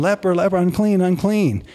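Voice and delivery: retold warning cry, pitched well up; leper's warning call. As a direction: act out a leper warning people away. Leper warns others off.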